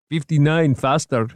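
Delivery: put-on high-pitched Middle Eastern-accented driver; thick Middle Eastern accent. A narrator's reading. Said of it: "in a thick Middle Eastern accent".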